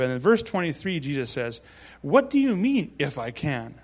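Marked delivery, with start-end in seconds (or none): 2.0-3.8 frustrated retort. Retold or imitated. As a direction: act out an exasperated challenge. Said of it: "evident of his frustrations".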